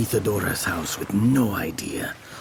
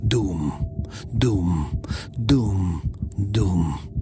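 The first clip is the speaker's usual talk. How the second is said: heavy thudding; 3 semitones lower, much slower than usual. A narrator's reading. Heartbeat sound words.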